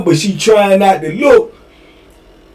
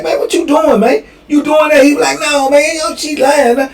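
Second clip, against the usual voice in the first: high accusing cellmate; 10 semitones higher than usual. Retold or imitated. Cellies accusing and denying.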